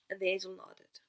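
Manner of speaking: plain reading voice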